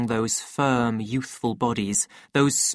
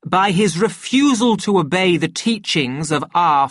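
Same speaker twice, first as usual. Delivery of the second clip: raised, emphatic public oratory; weighty formal accusation, key words punched; pompous director denouncing; 3 semitones higher, 4 dB louder than usual. Acted. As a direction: address the assembly with grave self-importance.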